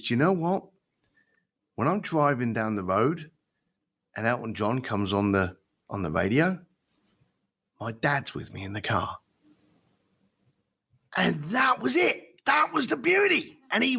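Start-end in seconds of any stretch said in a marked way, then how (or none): 0.0-9.2 voice changed. Retold or imitated. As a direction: let the voice drop as the memory takes over. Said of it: "his voice changed, his tone changed".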